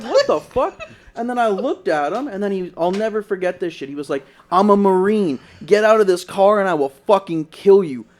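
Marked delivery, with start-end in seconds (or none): 0.0-0.8 high-pitched stunned disbelief, acted out; retold shock. Retold shock after being punched. 4.5-8.1 loud, forceful imitation of a threat; retold threat. Retold threat from attacking man.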